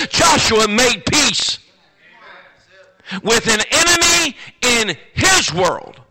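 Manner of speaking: loud, driving sermon; preaching at full force. Real, not acted.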